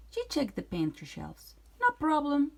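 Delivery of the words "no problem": high, louder witch voice; confident witch. Acted. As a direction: toss it off like a smug hag. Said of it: "said Gretch".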